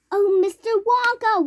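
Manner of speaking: high, loud despairing plea; mother wailing. A narrator's reading. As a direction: cry out to him. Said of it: "wailed Missus T V".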